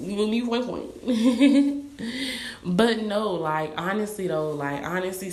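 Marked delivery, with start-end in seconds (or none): 0.4-2.6 slow, giggling through the words; laughing. Real, not acted. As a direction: laugh it out, amused at herself. Laughing at her own fantasy.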